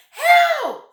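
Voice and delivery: shrieking high, loud Help; piercing panicked scream. Acted. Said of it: "screamed Missus Twit".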